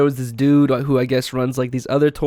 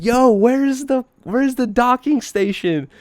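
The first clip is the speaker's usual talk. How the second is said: calling out; 9 semitones higher, slower than usual. Retold or imitated. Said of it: "I was just gonna scream to them".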